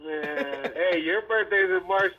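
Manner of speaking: ordinary talk, nothing marked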